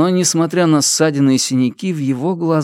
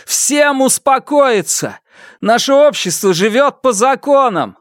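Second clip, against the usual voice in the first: high, forceful call to order; commanding shout; 12 semitones higher, 3 dB louder than usual. Acted. Фан Му calling an angry mob to order, commanding shout. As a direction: call the whole crowd to order.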